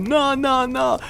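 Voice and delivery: squeaky put-on friend's voice; mock insistence. Barry brushes off his mates' objections, high mock protest.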